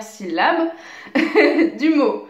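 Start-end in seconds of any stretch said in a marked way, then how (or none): 1.1-1.7 brief amused chuckle; short chuckle. Real, not acted. Teacher amused after saying avant-avant-dernière, short chuckle. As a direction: let out a quick little laugh.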